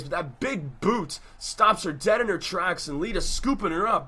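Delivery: usual speaking voice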